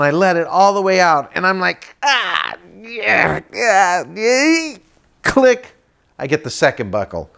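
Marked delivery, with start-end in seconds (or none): 2.0-4.8 high groans climbing higher; acted-out squeezing effort; straining grunts. Straining at the second buckle. 5.2-5.7 high-pitched click; buckle snap. Retold or imitated. Second buckle snapping shut.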